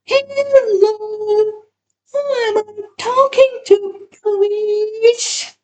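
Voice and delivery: high affected bedroom voice on the phone. Acted. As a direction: seduce the caller with a put-on sweetness. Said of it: "affects a bedroom voice".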